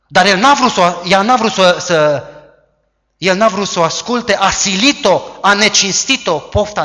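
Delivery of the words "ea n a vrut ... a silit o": usual speaking voice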